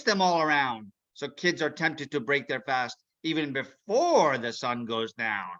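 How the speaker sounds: scheming villain, gleeful and sly. Acted. Mister Mean plotting to spoil Ramadan, scheming villain.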